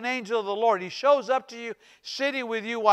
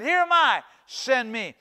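bold volunteering; 3 semitones higher than usual. Retold or imitated. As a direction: answer the call boldly. Isaiah's answer to God, retold.

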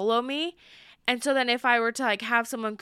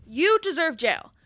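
higher, slower, heated declaration; heated repeat; 6 semitones higher, 3 dB louder than usual. Real, not acted. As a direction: lean into it, fired up. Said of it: "literally heated right now".

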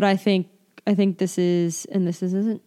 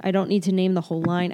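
ordinary talk, nothing marked

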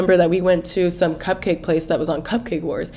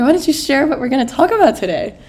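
usual speaking voice

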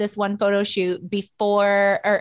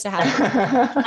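laughing; 3 semitones higher, slower than usual. Real, not acted. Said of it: "I just, like, choked on my water".

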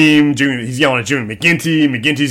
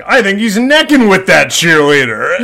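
imitating sportscasters; 6 semitones higher, 3 dB louder than usual. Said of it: "John Madden and Pat Summerall are like".

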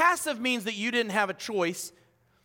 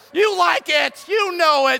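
hyping the crowd; 6 semitones higher, 7 dB louder than usual. Real, not acted. Hyping the congregation.

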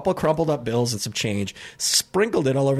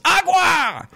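high, loud shouted "Agua!"; yelled demand; 5 dB louder than usual. Retold or imitated. Sweaty customer shouting for water at counter, loud yell. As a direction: bellow "Agua!" like a desperate thirsty man. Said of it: "he's yelling".

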